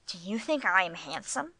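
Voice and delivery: ordinary delivery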